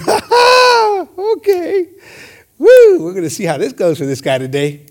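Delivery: high gloating taunt; mocking devil. Devil taunting the preacher before his day, gloating. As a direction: cackle at the preacher's bad day. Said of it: "the devil's going".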